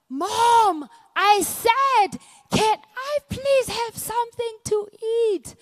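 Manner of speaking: put-on high, loud child's shout; son yelling. Eleven-year-old son yells at his half-deaf mom. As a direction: yell it like a kid out of patience.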